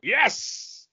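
high, slow, booming ho ho and cheer; excited shout. Retold or imitated. Retelling Carl Weathers cheering at craft service, high booming delight. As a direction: cheer like a thrilled big man spotting a treat. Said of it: "he hears from behind him, like".

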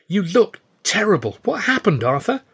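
high, worried friend's voice; alarmed friend. A narrator's reading. Stan alarmed at Arthur's battered face, high worried voice.

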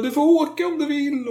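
very high falsetto mother voice giving in; hammed-up high mother; high pitched mock parent. Mother relents.

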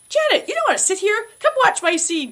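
high-pitched imitated coaxing; eager invitation. Actor invites her to watch his scene, high eager coaxing. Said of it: "So he says".

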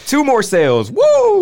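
loud, high mock cheer; hammed-up sales hype; mock celebration. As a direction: whoop it up over a tiny win. Mock celebration of tiny sales growth.

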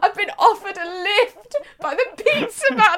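laughing high and loud; squealing with laughter. Retold or imitated. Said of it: "I was like".

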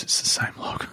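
soft, voiceless imitation of breaking down; retold breakdown. Retelling a film character finding the log, mock broken down. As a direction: act out her breakdown under the breath. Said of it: "she just breaks down and goes".

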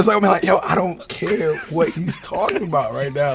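high, loud complaint easing off; real spicy-heat exasperation. Real, not acted. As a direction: struggle to focus through the burn. Struggling with question while burning.

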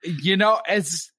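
ordinary talk

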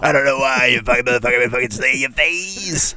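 hammed-up drill instructor rasp; strained instructor voice. Instructor berates a new recruit, in a strained mock rasp. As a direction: rant like an instructor with a wrecked voice. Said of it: "why is his voice like that?".

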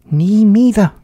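high, loud mouse squeak; tiny little voice. A narrator's reading. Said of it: "said a tiny little voice".

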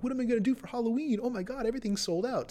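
high, rushed voice of someone scrambling; put-on frazzled fretting; mock panic. Acting out people scrambling for Halloween plans.

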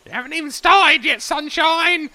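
old gnome Ola mocking the young man, loud and high. Ola teasing Sam.

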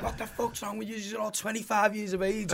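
laughing through a mock telling-off; a laughing high jab at the lads; laughing mock outrage. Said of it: "I'd be like".

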